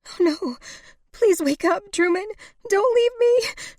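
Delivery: high wail building to a loud scream; grief-stricken. A narrator's reading. Woman begging dead lover to wake, high wailing. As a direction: plead frantically, climbing to a shriek. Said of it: "shook uncontrollably with grief and rage".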